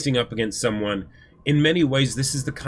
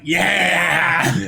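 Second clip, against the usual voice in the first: acted cheer; 3 semitones higher than usual. Acts out son's drawn-out reaction.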